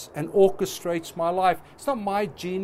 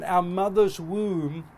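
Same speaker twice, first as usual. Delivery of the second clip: usual speaking voice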